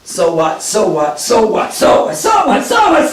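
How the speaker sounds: from calm to shouted frenzy; building to a shout. Acted. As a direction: start level and build to a yell. Repeated phrase building to shout.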